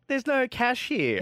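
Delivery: high, drawn-out mock complaint; slow, high comic quote; mock announcement. Mock quote of a text message.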